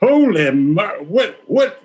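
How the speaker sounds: put-on high, slow shocked gasp of a scared kid; startled. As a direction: sputter in panic. Scared college guy reacting.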